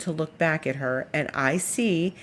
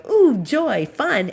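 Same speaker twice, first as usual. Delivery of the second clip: high, loud mock glee as the cat; delighted cat; 9 semitones higher, 6 dB louder, slower than usual. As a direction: play the cat thrilled by a new toy. Voicing cat spotting snake.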